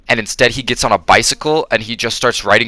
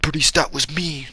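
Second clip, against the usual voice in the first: higher, softer put-on apology; Cage impression; 5 semitones higher, 5 dB quieter than usual. Cage apologizing to Bernice, higher, softer hammy impression. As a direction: confess it in a hammed-up soft Cage voice. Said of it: "he told her, he's like".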